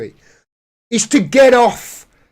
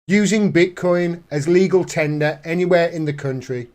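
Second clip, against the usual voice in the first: ordinary talk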